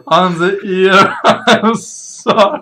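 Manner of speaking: high, loud, helpless laughter through the words; real laughing. Real, not acted. Game master apologizing for a slip, laughing hard. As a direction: correct yourself while cracking up. Cracking up over own slip.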